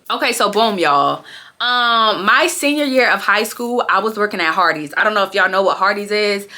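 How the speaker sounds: normal talking voice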